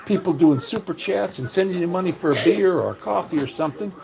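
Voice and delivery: high, heated comeback to a critic; retold indignant rebuke. Answering a critic who called him a beggar, indignant and high. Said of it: "And I'm like".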